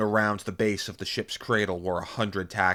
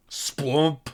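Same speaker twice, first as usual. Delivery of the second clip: raised, punchy splump; imitated squelching impact; 7 semitones higher, 5 dB louder than usual. A narrator's reading. Narrator as machine slams into Taxan, loud imitated splat. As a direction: make a loud splattering collision noise.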